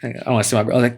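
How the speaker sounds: ordinary talk, nothing marked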